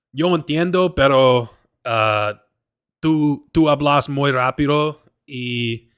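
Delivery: loud, halting Spanish with hesitations; speaking learner's Spanish. Shown as a demonstration. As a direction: pick out the Spanish slowly and emphatically, word by word. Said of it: "that's me speaking right there".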